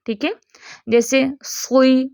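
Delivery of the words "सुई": सुई said slowly with a full heavy स; heavy letter. Shown as a demonstration. Teacher showing heavy letters with kasra, slow demonstration. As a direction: keep सुई heavy and drawn out. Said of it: "ये मोटे ही रहेंगे".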